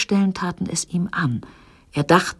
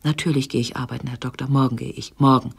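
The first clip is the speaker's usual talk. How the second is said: hurried pleading; 3 semitones lower, faster than usual. Acted.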